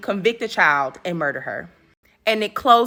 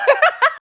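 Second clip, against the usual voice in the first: bright laugh; 3 dB louder than usual. Real, not acted. Laughing at own Neil quote.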